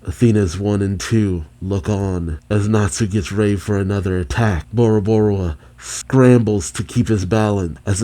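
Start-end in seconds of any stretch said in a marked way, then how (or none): none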